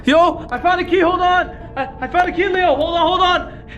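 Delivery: high, urgent shout; excited calling out. Acted. Telling Leo about the found key, excited high shout. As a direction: call the news through the wall.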